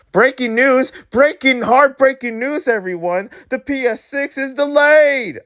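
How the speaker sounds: high, urgent news announcement; high-pitched fake bulletin; mock breaking news. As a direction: announce heartbreaking news with hammed-up drama.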